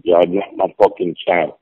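usual speaking voice

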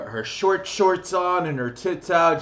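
high, loud, slow sneering; mocking. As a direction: drag it out with scorn. Mocking a streamer's look.

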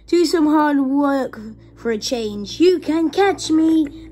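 high, loud taunt, slowing at the end; cheeky. A narrator's reading. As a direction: call out a cheeky challenge. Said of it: "in his cheeky way".

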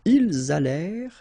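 "Ils allèrent" as il-zalèr, loud and clear; liaison. Shown as a demonstration. Teacher modelling the plural link, liaison.

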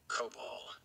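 very low, quiet growl; low grim warder. A narrator's reading. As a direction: announce it low and hard. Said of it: "he announced".

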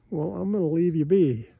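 ordinary talk, nothing marked

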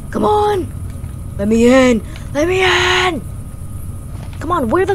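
high, loud desperate shouts; panicked yelling. Real, not acted. Gamer panicking as a monster chases. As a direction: scream to be let in.

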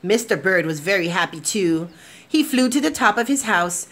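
gentle, expressive storybook narration; lively picture-book reading to kids; reading to children. A narrator's reading. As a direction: read it like bedtime story time. Reads picture book aloud.

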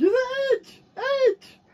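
high, loud excited scream. Real, not acted. Fan sees Edge return, high excited scream. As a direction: scream his name in delight.

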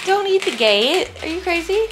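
scolding. Real, not acted. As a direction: scold the puppy. Scolding puppy.

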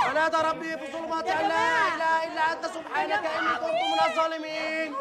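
measured chant on one held note; Quran recitation. Acted. Exorcist reciting over the possessed couple, measured chanting.

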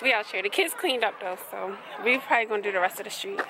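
ordinary talk, nothing marked

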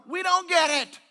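very high, full-volume yell; baffled outcry. Retold or imitated. Preacher voicing society's bafflement, yelled. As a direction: yell it in exasperated disbelief.

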